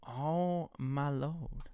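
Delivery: usual speaking voice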